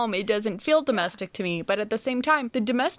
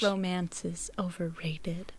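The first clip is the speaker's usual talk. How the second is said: softer whispered mock line; 3 semitones lower, 8 dB quieter than usual. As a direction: breathe it like a secret.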